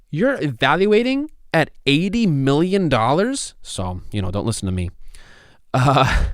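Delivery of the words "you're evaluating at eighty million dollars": incredulous question. Retold or imitated. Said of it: "And I go".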